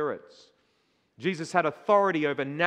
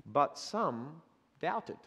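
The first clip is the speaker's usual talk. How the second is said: hushed reading; 8 dB quieter, much slower than usual. Acted. Verse read hushed and slow.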